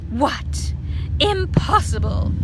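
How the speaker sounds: loud shocked what; shocked disbelief. A narrator's reading. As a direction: refuse to believe it. Missus Shin disbelieving.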